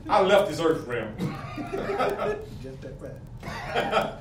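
real laughter breaking through the words; giggling with joy while speaking; laughing. Real, not acted. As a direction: laugh your way through the line. Laughing over his own rapture.

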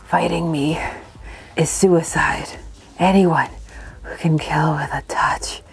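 low whispered cartoon villain. Reading villain dialogue aloud.

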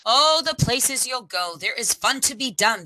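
plain reading voice

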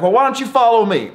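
high, fast mock invitation; Danny inviting the cyclist to follow, high fast mock voice; imitating the trucker. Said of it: "he's like".

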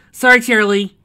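loud, high called-out apology; apologetic call. A narrator's reading. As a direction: call the apology across the battlefield. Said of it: "apologized Lyra said over Zord speakers".

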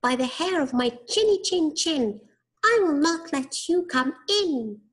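high squeaky pig voice; little pig voice. A narrator's reading. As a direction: squeak it defiantly like a piglet. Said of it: "said the little pig".

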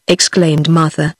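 ordinary delivery, nothing marked

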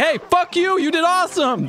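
high, hyped-up imitated cheer; screaming down the hall. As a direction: holler praise like an insult down a hallway. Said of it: "Just screaming down the hall".

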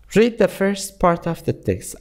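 slow, measured English reading for learners; slow read-aloud. Shown as a demonstration. Teacher reading a lesson text aloud to students, slow and deliberate. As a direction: read the passage slowly, phrase by phrase, with pauses. Rereads the task slowly.

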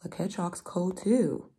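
usual speaking voice

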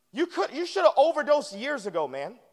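ordinary talk, nothing marked

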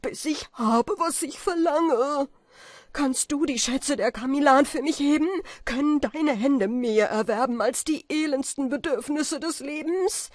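sehr hoch, sehr laut herausgeschrien; verzweifelter Schrei. A narrator's reading. Falke schreit weiter verzweifelt. (Falke schreit verzweifelt Kaspar an)

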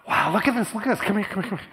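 high, loud, thrilled call to come look; excited doctor. Retold or imitated. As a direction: retell the doctor's excitement with a laugh. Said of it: "he was all excited".

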